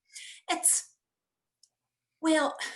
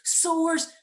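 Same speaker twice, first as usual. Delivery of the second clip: plain reading voice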